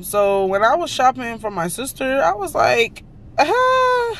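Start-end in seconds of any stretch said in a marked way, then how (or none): 3.4-4.1 high-pitched held "uh" of delight; mock squeal at a bargain; imitating a high, drawn-out reaction; excited little gasp. Acts reaction on seeing the sale.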